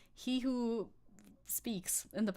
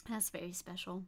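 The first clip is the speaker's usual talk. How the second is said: much softer, lower deadpan repeat; dry muttered aside; 4 semitones lower, 9 dB quieter than usual. Shown as a demonstration. Streamer on the Saxon accent, dry soft deadpan. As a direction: drop it low and dry, like a wry aside.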